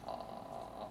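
very low, soft creak; vocal fry. Shown as a demonstration. Teacher demonstrating vocal fry, low popping creak. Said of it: "it's vocal fry".

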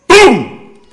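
a loud, high boom. Shown as a demonstration. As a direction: blast out the boom. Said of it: "Use onomatopoeia".

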